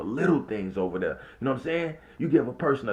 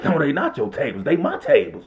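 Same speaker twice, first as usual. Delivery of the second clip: mock coworker snapping back; 4 semitones higher, 6 dB louder than usual. Coworker's retort in quarrel.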